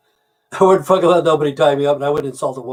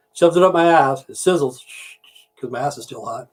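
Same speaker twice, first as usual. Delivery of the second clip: normal talking voice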